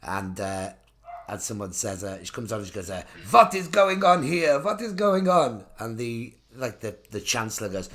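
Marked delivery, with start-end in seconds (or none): 3.3-5.6 loud, slow, higher fake German; hammy panto German baddie; faux German accent. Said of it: "a faux German accent".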